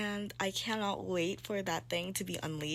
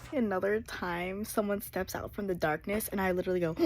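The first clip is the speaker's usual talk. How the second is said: normal talking voice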